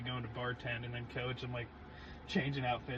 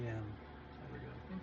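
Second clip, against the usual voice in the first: normal talking voice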